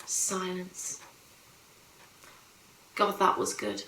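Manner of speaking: very soft, low, savouring each word; hushed relief. Acted. Mother savouring quiet after the children's tears, hushed relief.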